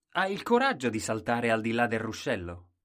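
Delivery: loud, changed-voice taunt; a courtier taunting Colombin, loud and teasing. Acted. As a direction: dare him out loud in another voice.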